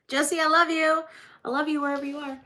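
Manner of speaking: excited shout-out to Jesse; affectionate shout-out. Real, not acted. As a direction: call out fondly to an absent friend. Shouting love to absent Jesse.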